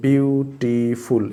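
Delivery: "বিউটিফুল" drawn out as three syllables, byu-tee-ful. Shown as a demonstration. Teacher showing three syllables in "বিউটিফুল", said syllable by syllable. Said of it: "এখানে তিনটে syllable রয়েছে".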